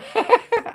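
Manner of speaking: quick high giggle; brief, pleased laugh; short real giggle. Real, not acted. Host thanks viewer for the name, short high giggle.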